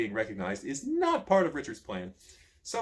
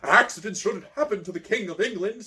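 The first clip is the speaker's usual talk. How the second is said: louder, higher, cold royal snarl; 6 semitones higher, 6 dB louder than usual. A narrator's reading. Prince John snarling at the page, louder and higher. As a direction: spit the words at the offender. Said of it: "snarls Prince John".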